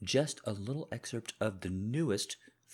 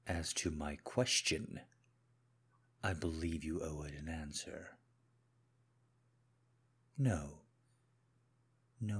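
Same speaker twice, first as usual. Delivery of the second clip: controlled menacing husband; 3 semitones lower than usual. Acted. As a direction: speak low and controlled, as if you already know. Husband confronts wife, audio drama.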